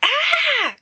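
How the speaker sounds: very high piercing scream; shrill scream. Acted. Carmina screaming over a broken nail, piercing scream. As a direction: scream out in sudden shock. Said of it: "Carmina screams".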